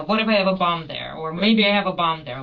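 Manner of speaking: said the word outright. Shown as a demonstration. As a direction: say bomb plainly and loudly, no spelling. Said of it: "No, no, I said the word".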